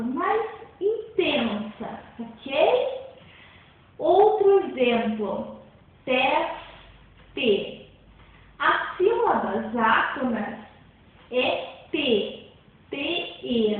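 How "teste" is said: "teste" split in two, tes and te, with a pause between; syllable by syllable. Shown as a demonstration. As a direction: break "teste" into separate syllables. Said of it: "Vamos colocar sílaba em sílaba".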